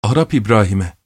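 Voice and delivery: usual speaking voice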